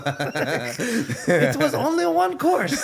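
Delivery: slow, high, laughing complaint; mock offended. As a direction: drag it out in fake outrage.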